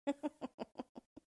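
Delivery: high malicious dwarf cackle; gloating little villain laugh; spiteful laugh. A narrator's reading. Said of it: "began to laugh spitefully".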